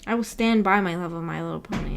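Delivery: usual speaking voice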